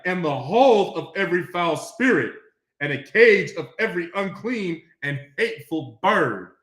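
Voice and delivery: booming pulpit proclamation; preaching scripture. Acted. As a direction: proclaim Babylon's fall at full force. Reads scripture aloud.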